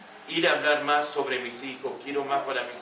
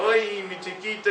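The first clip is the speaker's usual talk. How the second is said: higher-pitched doting coo, with a smile; cariño sonriente; 7 semitones higher than usual. Retold or imitated. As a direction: imitate fawning over your little boy. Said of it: "Yo podía estar con una sonrisa". (cariñoso, con voz aguda y sonriente)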